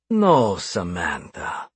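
slow, drawn-out menace; calm, unhurried threat, every word stretched; chillingly calm. A narrator's reading. Captor refusing his bound captive Samantha, chillingly calm. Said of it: "his tone chillingly calm".

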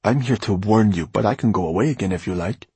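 put-on hot-headed young man's protest; angry. A narrator's reading. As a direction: push back, stung by the teasing. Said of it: "Farid's voice sounded angrier than he had intended".

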